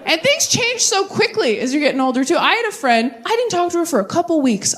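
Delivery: ordinary delivery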